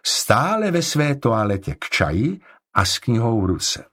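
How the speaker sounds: ordinary delivery, nothing marked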